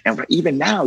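normal talking voice

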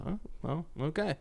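soft, doubtful okay; quietly puzzled going-along; hesitant acceptance. Retold or imitated. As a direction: imitate a reluctant order-taker. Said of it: "say it like that".

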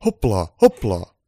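short, forceful command bursts; loud urging call; shouting harder. A narrator's reading. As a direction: yell to get the reindeer moving. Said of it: "Pukki huusi uudelleen, mutta lujempaa".

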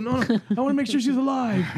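high-pitched comic worry; anxious insistence. Acted. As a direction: insist like a fretting new parent. Acted anxious parent insisting.

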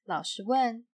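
ordinary delivery, nothing marked

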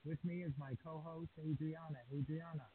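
distant, faint voice off the microphone; speaking far from the microphone; far from mic. Real, not acted. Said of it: "You sound a little, a little far".

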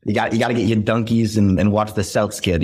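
Boston accent with dropped R's; sample a Southie-style Boston accent, R's left out. Shown as a demonstration. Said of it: "that's my Boston accent".

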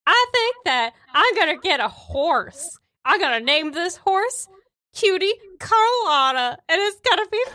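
high, sing-song fancy voice; Cutie Carlotta voice. Retold or imitated. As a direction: gush like a giddy dandy. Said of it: "And he's just like".

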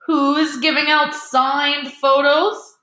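slow, high theatrical demand; pompous calling out. A narrator's reading. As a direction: sing it out grandly, stretching the words. Lockhart calls out to the crowd.

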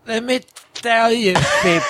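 Trump voice, high and far; brash Trump catchphrase; Trump impression. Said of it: "Is that".

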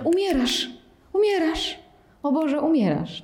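high, alarmed cries of dying; mock panic. Teacher mimics a panicking student, mock alarm. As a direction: play the frightened meditator.

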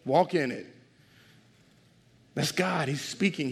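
normal talking voice

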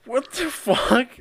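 high, loud and slow disbelief; floored, loud what-the-hell; stunned outburst. Real, not acted. Reacting to the absurd story.

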